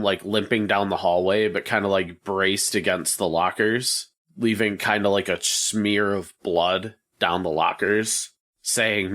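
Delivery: normal talking voice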